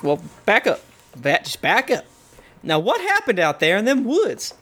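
put-on folksy Southern lawman accent. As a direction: talk someone down in a Southern constable's accent. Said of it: "has a southern accent saying that".

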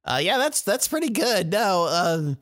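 high, chatty merchant; shopkeeper voice. Acted. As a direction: play the shrewd shopkeeper.

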